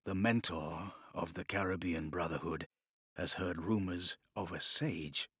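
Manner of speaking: low, soft confession; quietly. Acted. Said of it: "said quietly".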